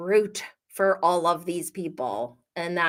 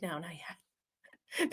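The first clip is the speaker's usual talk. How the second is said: sheepish reply; 3 semitones lower, 15 dB quieter, much slower than usual. Retold or imitated. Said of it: "Craig's like".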